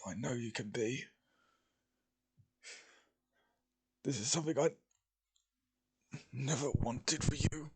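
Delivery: weak, halting dying man; fading last words, stammering. Acted. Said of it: "gonna have to try and do a dying voice here".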